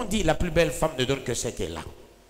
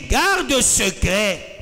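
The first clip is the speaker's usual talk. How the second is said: voice of God; 6 semitones higher, 3 dB louder, much slower than usual. Acted. Voicing God's command.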